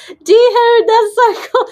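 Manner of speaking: loud, high-pitched burst of excitement; high excited yell. Real, not acted. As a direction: blurt it out, totally thrilled. Thrilled by viewer's ancestry comment.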